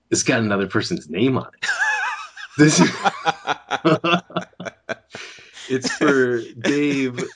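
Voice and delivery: real laughter breaking through the words; giggling over the wrong name; laughing. Real, not acted. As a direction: laugh it out in disbelief.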